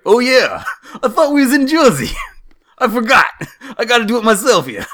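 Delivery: high, loud, laughing mock Jersey accent. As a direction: ham up a Jersey gas-station guy, laughing through it. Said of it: "accent is just gonna bleed over".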